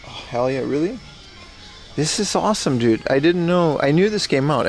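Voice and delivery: usual speaking voice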